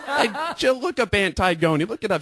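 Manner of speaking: high, loud mock office-lady call; imitating frantic ladies. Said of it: "They're like".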